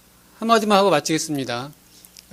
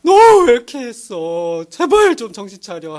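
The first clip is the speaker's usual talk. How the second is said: acted weeping plea, raised pitch and louder opening; tearful rebuke; 3 semitones higher, 5 dB louder than usual. Mentor begging a wrongdoer to wake up, mock-tearful. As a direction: wail it as a hammed-up, pleading scolding. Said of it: "하면서 울면서 해야".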